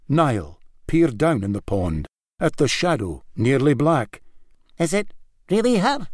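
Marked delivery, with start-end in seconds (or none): 4.8-6.1 much higher, quick, timid question; wondering. A narrator's reading. Young Niall wondering at the shadow in the pond. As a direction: ask in boyish disbelief. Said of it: "he wondered".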